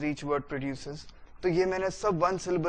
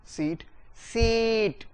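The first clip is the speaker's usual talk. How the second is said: सीट drawn out slowly and higher; slow stretched stress; 4 semitones higher, much slower than usual. Shown as a demonstration. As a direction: hold सीट like it floats. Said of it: "बढ़ा देना है". (सीट को धीरे, ऊँचा खींचें)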